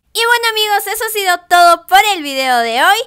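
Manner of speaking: youtuber's excited closing to viewers; upbeat sign-off. Real, not acted.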